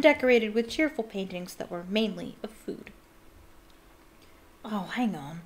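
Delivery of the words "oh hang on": low, soft, hesitant realization; slowly, dawning. A narrator's reading. Harry halfway down the corridor, slowly realizing. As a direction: say it softly as it dawns. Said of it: "said Harry slowly".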